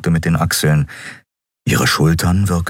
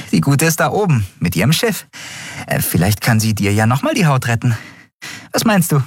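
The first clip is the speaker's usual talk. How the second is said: put-on high street-kid girl voice; lively young girl, matter-of-fact; 8 semitones higher than usual. A narrator's reading.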